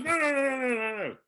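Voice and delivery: high, emphatic repeated no; insistent old maestro. Retold or imitated. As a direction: wave it off like a fussy genius. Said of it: "He goes".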